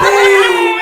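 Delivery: very loud, high shouted "boom"; explosive music drop. Retold or imitated. Storyteller imitating song starting as he hits play, explosive shout. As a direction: imitate speakers blasting back in.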